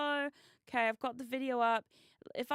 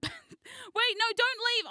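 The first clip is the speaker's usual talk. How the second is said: high-pitched, half-laughing begging; urgent plea; 11 semitones higher, 3 dB louder, much slower than usual. Real, not acted. Streamer begs a viewer to stay, urgent plea. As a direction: plead high and quick.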